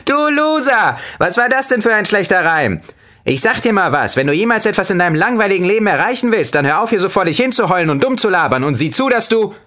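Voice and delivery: ranting lemon. A narrator's reading. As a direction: rant at him like a manic lemon.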